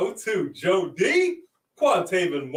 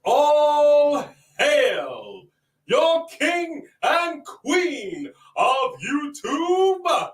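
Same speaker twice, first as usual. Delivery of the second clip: put-on royal proclamation accent; 9 semitones higher, much slower than usual. Hailing the king and queen of YouTube, royal proclamation accent. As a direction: proclaim it like a hammed-up town crier. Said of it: "I know what this accent is".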